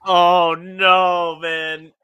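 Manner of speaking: high, loud groan of sympathy; loud dismay. Real, not acted. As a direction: cry out in dismayed commiseration. Reacts to family's bad luck.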